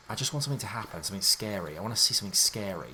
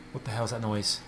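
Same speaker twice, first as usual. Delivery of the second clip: normal talking voice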